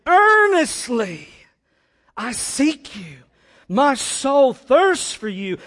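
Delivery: slow, loud, high cry; full-force impassioned psalm reading; desperate intensity. Shown as a demonstration. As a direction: stretch each phrase out in fervent longing. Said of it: "intensity and desperation for God".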